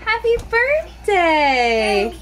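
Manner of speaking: put-on sweet singsong toddler stand-in; speaking for the child. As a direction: chirp it on the little one's behalf. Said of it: "He says".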